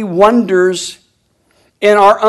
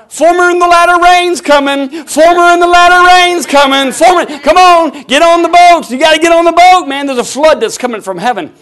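high, loud preaching cry as Noah; Noah's warning; 8 semitones higher, 8 dB louder than usual. Retold or imitated. Preacher as Noah urging people onto the boat, loud and high. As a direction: call the people onto the ark. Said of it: "I've been feeling like Noah".

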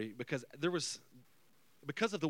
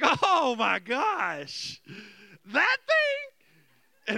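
high, loud, drawn-out comic squeal; mock hysterics, voice shooting up; bursting into laughter; 10 semitones higher, 8 dB louder, much slower than usual. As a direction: act out helpless amusement. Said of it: "I just start laughing".

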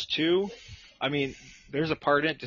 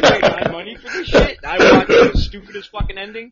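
loud, high indignant reenactment; worked-up, incredulous retold complaint; pissed-off rant; 7 semitones higher, 13 dB louder than usual. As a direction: relive the outrage at full volume. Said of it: "I was so pissed".

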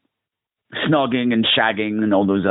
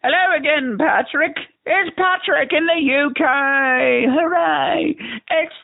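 high greeting; slower than usual. Acted.

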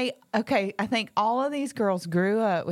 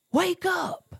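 high-pitched call-out; mock yell; 14 semitones higher than usual. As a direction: imitate players heckling from the dugout. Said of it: "they'll yell out".

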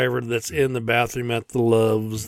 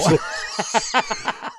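slow, high, mocking drawl; 7 semitones higher, 3 dB quieter, much slower than usual. Real, not acted. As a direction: stretch it out in disbelief. Mocking the word, laughing.

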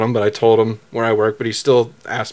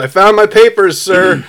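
raised, higher-pitched call; retold yell; 6 semitones higher, 4 dB louder than usual. Driver yells to the officer out the window, retold yell. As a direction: imitate shouting out a car window. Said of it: "I'm yelling out the windows".

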